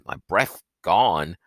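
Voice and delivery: ordinary delivery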